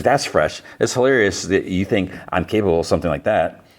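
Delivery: normal talking voice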